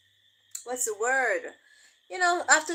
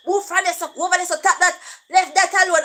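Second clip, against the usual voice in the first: quick, louder patois orders with a laugh; patois commands; 5 semitones higher, 5 dB louder, faster than usual. Retold or imitated. Mother ordering her son away in patois, fast and louder. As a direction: rattle off orders to a restless toddler. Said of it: "me a chip in the patois them".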